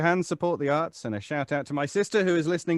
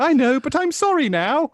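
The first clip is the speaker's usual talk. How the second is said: voicing the cat; 10 semitones higher, 8 dB louder than usual. Voicing the cat's reply.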